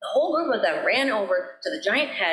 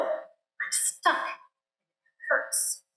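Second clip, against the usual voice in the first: soft breathy complaint; pained; 6 semitones higher, 5 dB quieter, much slower than usual. A narrator's reading. Trapped mouse tells the gazelle it hurts, softly. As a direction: whimper it softly.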